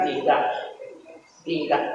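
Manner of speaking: low, soft, firm refusal; calmly flat-out say no; firm low denial. Retold or imitated. Preacher to his friends, rejecting their boast: firm low denial.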